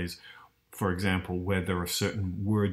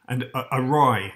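"awry" with a rolled West Country R, louder; West Country burr; 4 semitones higher, 6 dB louder than usual. Retold or imitated. Pointing out the actor's West Country R, "awry" with a burr. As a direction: lecturer mimics a burr on "awry". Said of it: "that sort of West Country burr".

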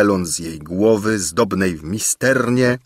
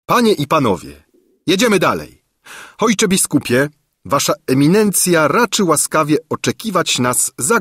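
a lightly voiced king, raised and higher; young king's announcement; 8 semitones higher than usual. A narrator's reading. King announcing to guests and bishop, lightly higher. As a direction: address the court with cheer.

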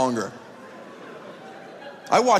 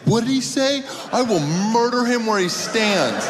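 outraged crowd; 7 semitones higher than usual. Retold or imitated. New Orleans crowd reacting to insult, mock outrage. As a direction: sputter like an offended fan. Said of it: "They couldn't even comprehend it".

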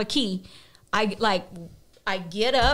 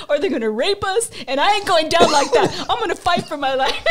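higher pitched, rushed excited planning; frantic; 7 semitones higher than usual. Retold or imitated. To his friend, planning to jump from the window, frantic. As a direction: pitch the escape plan breathlessly high.

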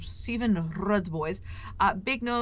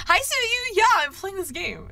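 excited anime fan; 10 semitones higher, 6 dB louder than usual. Real, not acted. Excited greeting to chat.

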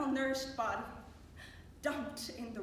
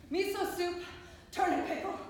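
higher, more forceful listing; raised, insistent voice on a repeated menu; forceful repetition; 5 semitones higher, 3 dB louder than usual. Acted. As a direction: hit "Miso soup" and "Turnip pickle" hard and high. Food list repeated with rising force.

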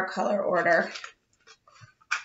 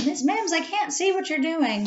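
higher-pitched imitation of a schoolkid; pupil complaining; 7 semitones higher than usual. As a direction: mimic a kid piping up in class. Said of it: "I do with the kids too".

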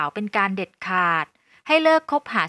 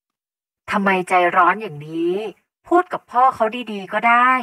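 slow, exasperated scolding held in check; restrained reproach; 5 dB louder, slower than usual. Acted. Mother following her daughter upstairs after the quarrel, restrained reproach. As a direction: chide her while keeping your temper. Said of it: "พยายามข่มอารมณ์ให้เป็นปกติ".

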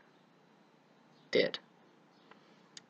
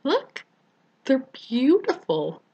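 high, bright squeal of pride; excited showing off; 9 semitones higher, 5 dB louder, slower than usual. Retold or imitated. To friends, showing off new teeth, thrilled high squeal. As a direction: show off the new smile, voice jumping up. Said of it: "I'm going".

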